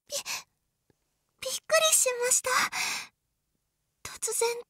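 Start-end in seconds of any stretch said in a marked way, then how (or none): 0.1-2.5 flustered surprise. Acted.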